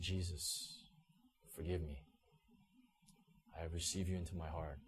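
low, very soft plea to God; hushed, humble confession; quiet prayer. Retold or imitated. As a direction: pray it privately, with long pauses. Retold bedside prayer of conversion.

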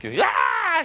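short, loud mock wail; sudden loud tantrum shriek, acted out; imitated toddler scream. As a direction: imitate a screaming child's cry. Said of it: "She was screaming and tearing up".

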